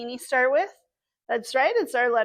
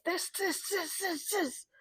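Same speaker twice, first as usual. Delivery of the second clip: softer repeated this; soft tracing; 5 semitones higher, 13 dB quieter than usual. Acted. Librarian tracing the letter shape for kids, softly. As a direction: hush each word as you trace.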